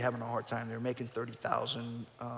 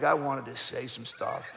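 ordinary talk

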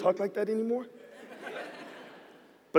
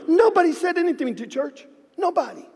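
building emphasis; 10 semitones higher than usual. Acted. Pastor stressing that nobody spoke to the church, high and emphatic. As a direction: push nobody up high in disbelief. Escalating repeat of the silence.

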